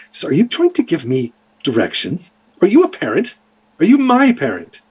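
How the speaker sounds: indignant questions climbing high; scolding mother. Acted. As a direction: challenge the child, pitch rising with each question. Mother scolds child in story.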